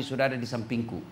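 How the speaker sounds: soft, low dying words; quiet reply. Pretend. The dying Prophet quietly answering Fatimah. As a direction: say it softly, as if near death. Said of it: "Kata Nabi".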